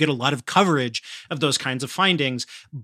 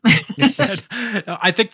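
laughing; 7 semitones higher, 4 dB louder than usual. Real, not acted. Host laughs after guest's answer.

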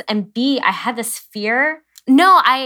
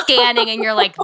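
ordinary talk, nothing marked

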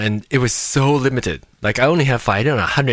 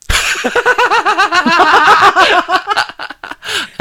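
high, loud cackling laugh; long laughing fit; 5 dB louder than usual. Real, not acted. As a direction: crack up at your own dirty joke. Cracks up at own crude joke.

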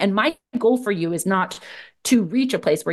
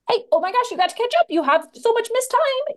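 much higher, frantic nagging voice in the head; panicky inner voice. Retold or imitated. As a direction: play the anxious voice in your head, pitched way up. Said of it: "the narrative pop up in the back of my head saying".

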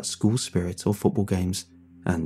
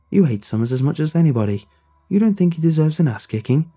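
aggressive; 7 semitones higher, 4 dB louder than usual. Acted. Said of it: "Alan said darkly".

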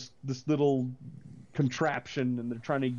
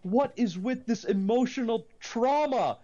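exasperated outburst; 8 semitones higher, slower than usual. Retold or imitated. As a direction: vent at the movie in disbelief. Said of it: "and I'm like".

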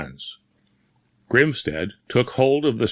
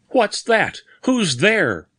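much higher, terror-laden questions; high-pitched terror; 11 semitones higher, slower than usual. A narrator's reading. Bearded man staring at the bed, high-pitched terror. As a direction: cry out at something unseen, terrified. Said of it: "whispered in high-pitched, terror-laden accents".

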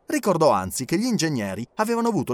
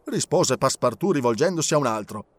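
ordinary delivery, nothing marked

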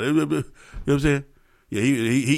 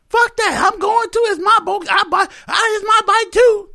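much higher, louder shrieking woman's voice; angry girlfriend; 3 dB louder than usual. Retold or imitated. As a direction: yell back as the furious girlfriend. Girlfriend voiced yelling back.

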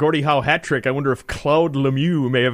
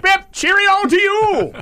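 high, slow, posh radio sign-off; old-timey radio voice; 4 dB louder, slower than usual. Acted. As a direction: tip your hat like a 1940s announcer. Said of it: "my old-timey radio voice".

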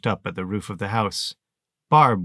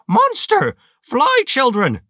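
a far higher, loud, piercing shriek; 8 dB louder, slower than usual. Acted. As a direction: shriek the warning at the top of the voice. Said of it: "she screamed".

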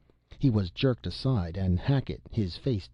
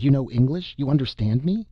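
amazed excitement; 6 semitones higher, 5 dB louder than usual. A narrator's reading. Stunned man finds English speaker.

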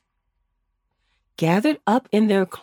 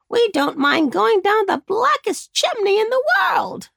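high squawky crow; 10 semitones higher than usual. Acted. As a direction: talk like a big crow. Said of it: "said the largest crow".

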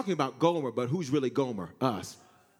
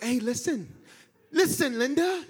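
coaxing voice; 9 semitones higher, slower than usual. Acted. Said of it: "He's like".

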